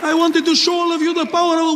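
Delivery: put-on high accented explanation, cut off; protesting bomber. Would-be bomber justifying himself to passenger, protesting accented voice. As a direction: try to explain in a put-on Middle Eastern accent.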